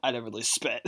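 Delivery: ordinary talk, nothing marked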